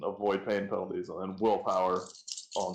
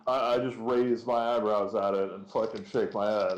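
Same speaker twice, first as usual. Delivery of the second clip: ordinary talk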